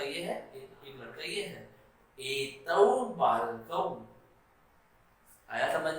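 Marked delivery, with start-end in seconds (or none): 2.2-4.1 एतौ बालकौ stretched out syllable by syllable; very slow Sanskrit demo. Shown as a demonstration. Teacher drilling the dual form for the class. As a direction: spell out एतौ बालकौ very slowly. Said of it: "द्विवचन क्या होता है".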